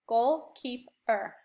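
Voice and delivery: goalkeeper drawn out very slowly, goal and keeper apart; slow syllable split. Shown as a demonstration. Teacher modelling the goalkeeper syllable game, very slow. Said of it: "by going goalkeeper".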